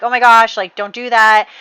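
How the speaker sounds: wife begging her lover to stop; loud pleading. Retold or imitated. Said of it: "trying to, you know, be like".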